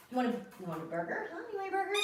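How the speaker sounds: fast cooing pet talk, rising high; talking to a baby. Acted. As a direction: tease the dog with a treat. Coaxing dog with squeaky toy.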